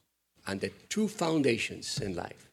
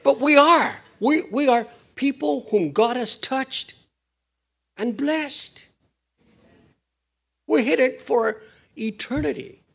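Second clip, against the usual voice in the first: much higher and louder, proclaiming; full-force preaching; 13 semitones higher, 7 dB louder than usual. Acted. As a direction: lift the room with a bold proclamation.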